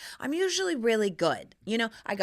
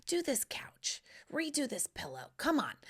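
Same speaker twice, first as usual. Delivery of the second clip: hushed demands; 4 semitones higher, 8 dB quieter than usual. Pretend. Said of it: "could just throw them".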